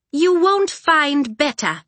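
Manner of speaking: put-on high, slow young girl. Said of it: "Nettle's voice was still young, clinging to the higher notes".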